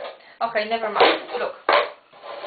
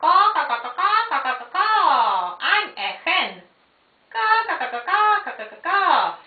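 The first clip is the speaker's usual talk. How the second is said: hen clucking; 3 semitones higher, faster than usual. Acted. Voicing a toy hen.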